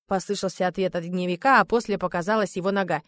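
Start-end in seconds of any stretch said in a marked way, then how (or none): none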